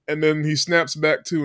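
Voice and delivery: ordinary delivery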